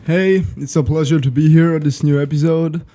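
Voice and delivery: ordinary talk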